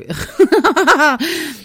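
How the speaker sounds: bright burst of laughter; real laugh. Real, not acted. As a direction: laugh out loud at the rush. Laughs at squeezing it in.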